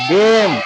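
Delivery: गेम में ए को खींचकर, अंत म पर; गेम का ए लंबा, आख़िरी ई चुप; लंबा स्वर. Shown as a demonstration. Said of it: "इसको लंबा करके बोल देंगे". (गेम को लंबा करके बोलें)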